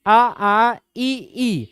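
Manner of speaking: आ then ई, long and clear; vowel demo. Shown as a demonstration. Teacher showing class the आ to ई vowels. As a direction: glide from आ into ई with the tongue up. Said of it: "स्वर क्या आएगा?".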